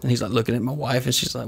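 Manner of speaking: ordinary delivery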